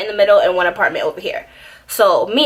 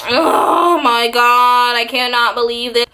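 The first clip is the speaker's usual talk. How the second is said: dramatic wail; 2 semitones higher, slower than usual. Retold or imitated. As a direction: overact a kid's despair. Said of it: "I was a very dramatic kid".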